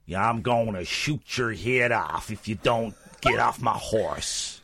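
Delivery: slow drawling cowboy voice; cowboy accent. Pretend. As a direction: put on a Texan cowboy drawl. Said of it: "you talk like a cowboy then".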